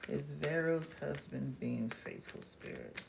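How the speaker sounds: quiet invocation, dropping to a whisper; hushed. Real, not acted. Reader asking Spirit while shuffling, hushed and fading. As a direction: ask softly, fading away on the last word.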